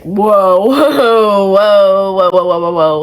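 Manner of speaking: loud, laughing string of whoas; a loud run of whoas, half laughing; panicked whoas. Retold or imitated. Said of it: "at the time I was like".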